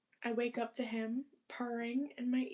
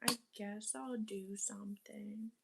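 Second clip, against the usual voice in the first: whispering; 4 dB quieter than usual. Real, not acted. Talking to herself.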